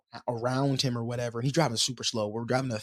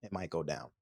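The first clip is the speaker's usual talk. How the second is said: worried inner thought; 7 semitones lower, 9 dB quieter than usual. Retold or imitated. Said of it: "that was my first instinct".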